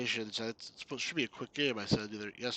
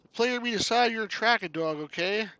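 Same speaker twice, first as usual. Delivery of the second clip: higher, louder cartoonish Canadian voice; Canuck hero addressing dog; 9 semitones higher, 8 dB louder than usual. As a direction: talk up to a dog as a chirpy Canuck.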